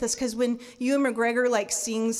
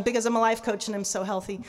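normal talking voice

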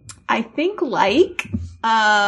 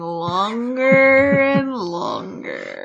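slow, stretched mock moan; drawn-out complaint; slower than usual. Co-host teases that the bits run long, words stretched out slowly. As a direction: drag out each longer.

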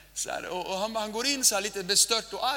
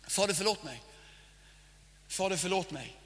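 praying. Shown as a demonstration. Modelling a prayer. (stilla, ödmjuk bön)

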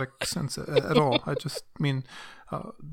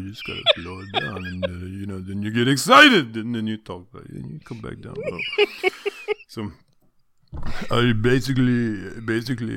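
dopey macho hunk; 2 semitones lower than usual. Pretend. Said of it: "existential meathead prophet voice of Sylvester Stallone".

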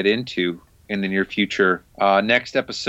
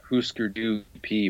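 whisper; 2 semitones lower, 6 dB quieter than usual. Real, not acted. Drops to a whisper mid-sentence.

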